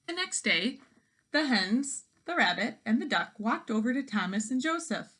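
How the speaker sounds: ordinary delivery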